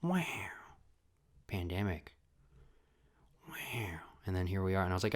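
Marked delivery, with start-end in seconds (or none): 0.0-4.0 hushed stunned. Retold or imitated. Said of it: "like, silent".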